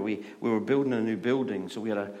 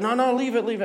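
high, loud, hurried imitation of pleading; urgent pleading; 13 semitones higher, 7 dB louder, faster than usual. Wife telling husband to leave it, urgent. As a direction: act out begging him to drop it.

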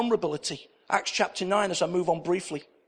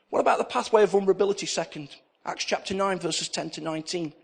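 usual speaking voice

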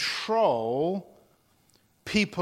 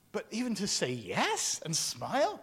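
ordinary delivery

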